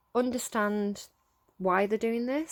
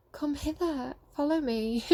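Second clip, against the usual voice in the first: beckoning; 6 semitones higher, slower than usual. Retold or imitated. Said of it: "that kind of like".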